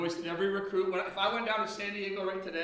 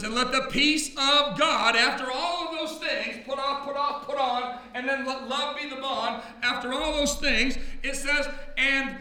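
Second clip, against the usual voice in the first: fervent, raised preaching voice; full-force sermon delivery; 3 semitones higher, 3 dB louder than usual. Acted. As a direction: preach it to the congregation.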